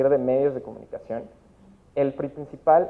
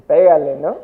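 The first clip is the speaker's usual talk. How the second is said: mock parent advice; 11 dB louder than usual. Voicing every parent's advice.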